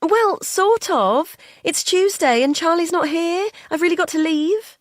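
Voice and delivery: every sentence goes up like a question; high, impatient uptalk; uptalking carer. Acted. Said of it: "goes up at the end of every sentence".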